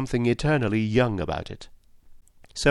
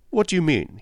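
unsteady voice; 6 semitones higher than usual. A narrator's reading. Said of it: "in an unsteady voice".

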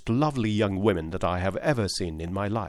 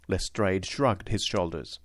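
ordinary delivery

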